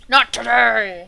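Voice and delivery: slow, loud hammed-up exclamation; loud mock echo. Player echoing the game's narrator, loud and hammed-up. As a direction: drag it out loudly in mock defiance.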